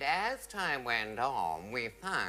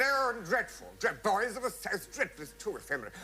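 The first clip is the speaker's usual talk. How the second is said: 1 disdainful officer; 10 semitones higher than usual. Retold or imitated. Officer mocks effeminate number.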